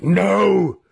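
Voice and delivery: high, loud scream; desperate scream. A narrator's reading. Wizard as the spirit slips away, screaming. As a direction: scream it out in protest. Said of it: "he screamed".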